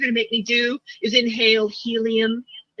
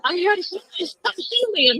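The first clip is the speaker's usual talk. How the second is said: helium voice; 8 semitones higher, much slower than usual. Acted. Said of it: "did it make my voice sound different".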